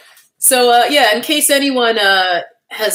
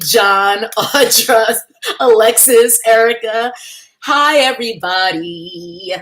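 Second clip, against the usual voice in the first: normal talking voice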